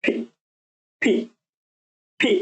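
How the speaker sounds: ফি with folded lips and a puff of air; demo sound. Shown as a demonstration. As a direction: press the lips together, then release ফি. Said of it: "ঠোঁট ভাঁজ করে ফি".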